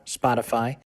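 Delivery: usual speaking voice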